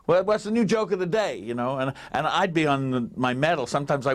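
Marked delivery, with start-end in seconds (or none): 0.0-1.4 high, eager "Hey, [name], [name]"; Elvis asking eagerly. Retold or imitated. Elvis asks his friend for the day's joke, high and eager. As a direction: Elvis pestering him for a new joke. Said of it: "he says".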